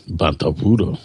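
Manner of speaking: low alien crime-lord voice; low-pitched creature impression; Jabba the Hutt. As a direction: say it deep, like a huge alien slug. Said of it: "saying Bantapudu in your Jabba voice".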